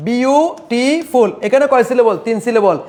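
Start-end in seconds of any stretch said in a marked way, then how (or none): 0.1-1.3 beautiful slowly split as বিউ, টি, ফুল; beautiful stretched syllable by syllable; broken into syllables. Shown as a demonstration. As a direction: count out the three parts of beautiful with gaps between. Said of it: "তিনবার ভাঙতে পারি".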